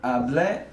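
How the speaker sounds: Hablé stressed on the final é, ah-BLEH; stress on the end. Shown as a demonstration. Teacher showing stress on the final é of Hablé. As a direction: hit the last syllable of Hablé. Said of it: "The A does have an accent".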